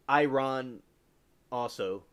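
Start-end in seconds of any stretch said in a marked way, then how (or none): 0.1-0.8 Iran said eye-RON; alternative pronunciation. Shown as a demonstration. Admitting own usage to chat, Iran as eye-RON. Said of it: "I have said".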